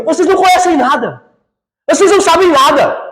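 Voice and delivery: preacher voicing Jesus rebuking; firm rebuke. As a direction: cut them down with conviction. Jesus rebuts the boast.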